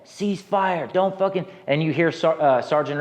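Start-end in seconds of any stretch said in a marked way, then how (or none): 0.0-1.5 mock commander yelling; screaming cease fire. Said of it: "is screaming over in the net".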